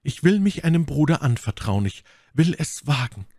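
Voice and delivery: high, pained cry of never; lady's anguished refusal. A narrator's reading. My Lady voiced by narrator. (My Lady weigert sich verzweifelt, hoch und laut)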